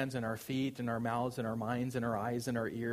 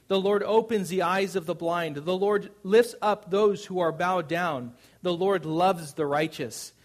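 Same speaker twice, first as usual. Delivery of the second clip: loud, raised declamation of a psalm; preaching scripture reading; 4 semitones higher, 6 dB louder than usual. Acted. Preacher declaims the psalmist's praise to the congregation, loud and exalted. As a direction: proclaim each verse with ringing force. Reads the psalm aloud forcefully.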